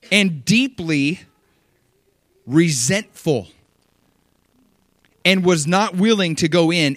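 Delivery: slow, loud stress on angry and resentful; dramatic emphasis. Real, not acted. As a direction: lean hard on angry, then deeply resentful. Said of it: "reading with emphasis".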